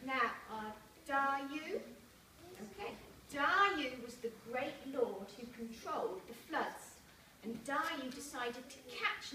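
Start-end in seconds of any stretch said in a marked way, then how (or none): none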